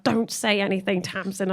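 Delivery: quiet mum warning; mum hushing. Retold or imitated. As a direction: hush her firmly. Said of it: "And she's like".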